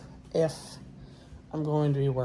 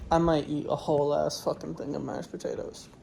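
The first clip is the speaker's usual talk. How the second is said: ordinary delivery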